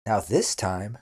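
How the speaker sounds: normal talking voice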